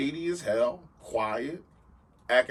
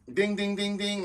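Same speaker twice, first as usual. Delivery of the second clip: alarm bells; 9 semitones higher than usual. Retold or imitated. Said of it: "automatically just".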